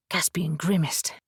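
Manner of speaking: usual speaking voice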